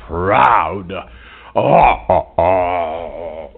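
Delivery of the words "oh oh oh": mock sobbing oh. [name] wails on air.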